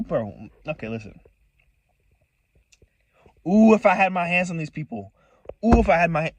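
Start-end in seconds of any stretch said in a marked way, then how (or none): 3.5-6.3 loud, raised-pitch burst of frustration; itching to get at them, loudly; seething outburst. Real, not acted. Venting about revenge on them.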